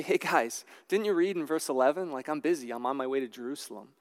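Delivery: soft, quick, offhand brush-off; a hint of busy impatience, tossed away quickly; dismissive brush-off. Pretend Jesus waving off the lepers, quick soft brush-off.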